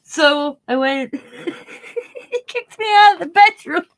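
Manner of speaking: high, slow words squeezed out through laughter; can barely get it out for laughing; laughing hard. Real, not acted. Laughing while recounting being kicked out.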